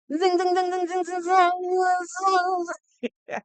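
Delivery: high, buzzy mock fly sound zing zing; buzzing fly noise. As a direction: buzz like a pesky fly circling the wall. Mimicking a fly on wall.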